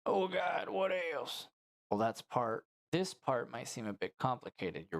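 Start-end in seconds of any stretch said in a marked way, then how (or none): 0.0-1.5 higher-pitched patient voice; dismayed patient. Acted. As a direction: dread the doctor's next news. Acted patient dreading doctor's news.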